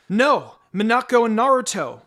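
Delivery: loud, high cry of alarm; alarmed shout. Acted. Father shouting in alarm at his children, loud and high. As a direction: shout the names in panic.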